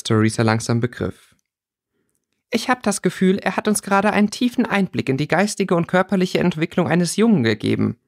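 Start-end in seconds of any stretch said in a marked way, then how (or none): 2.5-8.0 higher-pitched, dazed amazement; astonished. A narrator's reading. Theresa realising what Merton just revealed, astonished. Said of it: "murmelte sie erstaunt".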